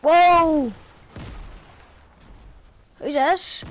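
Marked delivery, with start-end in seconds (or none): 0.0-0.7 a loud, startled whoa; a real startled exclamation. Real, not acted. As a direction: blurt it out, caught off guard. Sudden surprise during game.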